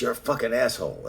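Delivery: high-pitched mock hostility; retold insult. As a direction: imitate someone flinging an insult. Retold insult from club acquaintance.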